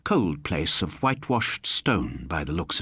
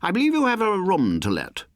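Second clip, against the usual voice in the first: resonant educated voice; 12 semitones higher than usual. A narrator's reading. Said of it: "in a resonant, educated voice".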